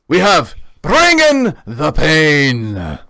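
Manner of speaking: loud, very slow fighter name call; announcer intro. Acted. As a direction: stretch the fighter's name for the crowd.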